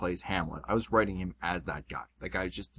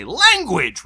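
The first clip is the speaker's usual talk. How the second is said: mock scolding; 9 semitones higher, 11 dB louder than usual. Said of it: "the one who gets to say".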